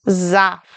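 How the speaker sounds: Saft with a voiced buzzing Z at the start; Saft, starting with a clear zz sound. Shown as a demonstration. Teacher showing learners the German S as Z in Saft. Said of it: "Sounds like a Z".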